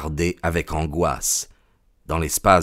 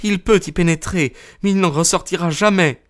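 high-pitched exulting woman's voice; acted high female exclamation; vindictive cry; 10 semitones higher than usual. A narrator's reading. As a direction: cry out high, with grim triumph. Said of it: "s'écria-t-elle".

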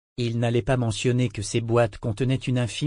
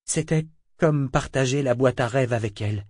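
ordinary delivery

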